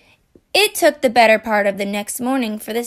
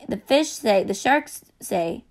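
usual speaking voice

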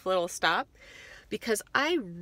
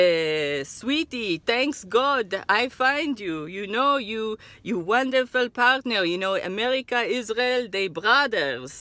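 put-on Israeli accent; 3 semitones higher, 5 dB louder than usual. Seller flattering customer.

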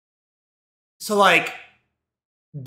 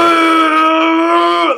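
long, high, loud held yell; powering-up scream; 10 semitones higher, 8 dB louder than usual. Retold or imitated. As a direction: hold a high roar like powering up. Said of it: "He starts, like, powering up".

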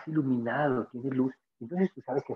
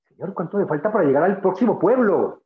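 insistent asking; 6 semitones higher, 7 dB louder than usual. Retold or imitated. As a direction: ask again, louder and higher. Traveler asks again, pressing harder.

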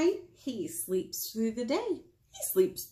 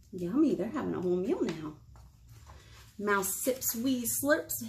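plain reading voice

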